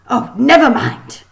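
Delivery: furious teacher giving up; exasperated. A narrator's reading. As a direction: throw up your hands. Kraken gives up exasperated.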